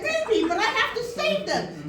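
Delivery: high, loud, insistent pleading; mock-heroic plea. Acting out plea to partner.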